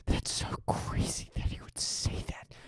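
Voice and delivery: hushed breathless disbelief, mocking; mock stunned whisper. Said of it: "standing there going".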